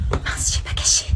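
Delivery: unvoiced panicked whisper to herself; whispered aside. Caught daughter whispering to herself in panic. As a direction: hiss it so mom can't hear.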